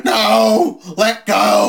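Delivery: high, loud mock struggle; hammed-up shouted protest; wrestler's voice. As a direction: yell as a wrestler being held. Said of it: "Do it in his voice".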